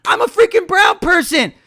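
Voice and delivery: high-pitched outburst at the TV; indignant retort. Retold or imitated. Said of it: "I'm like".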